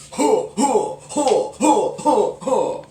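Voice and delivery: rapid high karate huhs; six karate grunts. Shown as a demonstration. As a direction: fire off quick loud grunts. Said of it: "pretend like SpongeBob".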